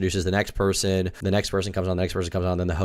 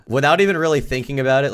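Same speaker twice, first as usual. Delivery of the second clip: usual speaking voice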